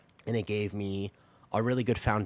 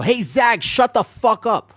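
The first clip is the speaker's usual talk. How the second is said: abrupt full yell, much higher and louder; sudden demo yell; 12 semitones higher, 13 dB louder, slower than usual. Shown as a demonstration. Streamer startles Zag to demonstrate a sudden pitch change, yelling. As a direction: burst out shouting with no warning. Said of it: "So the sudden pitch change".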